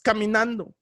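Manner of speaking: usual speaking voice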